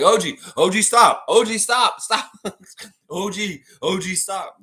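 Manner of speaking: loud, high calls of stop; pleading shout. Retold or imitated. Pascal yelling at O G to stop throwing bottles, loud pleading. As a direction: yell at a teammate to knock it off. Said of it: "He's like".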